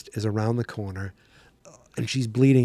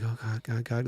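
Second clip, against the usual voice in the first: soft, acted fright under the breath; mock-frightened quiet stammer; frightened muttering; 8 dB quieter than usual. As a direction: quietly imitate a scared man praying. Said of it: "you could tell he is frightened".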